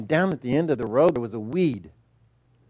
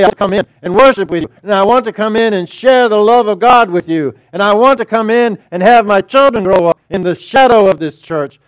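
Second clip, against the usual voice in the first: loud, fervent preaching; 6 semitones higher, 9 dB louder than usual. Acted. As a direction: preach it out with fire. Preaching at full force.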